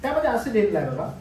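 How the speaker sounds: normal talking voice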